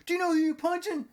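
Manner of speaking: high, loud indignant squawk; outraged punched thug. Acted. Thief punched by shop owner, high hammed-up outrage. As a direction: fire it out high and fast.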